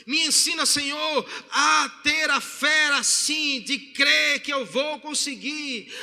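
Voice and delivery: slow, forceful pleading prayer; acted prayer rising to a loud cry, easing at the end; fervent prayer. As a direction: preach the prayer out, phrase by drawn-out phrase. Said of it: "aquela foi a oração do homem".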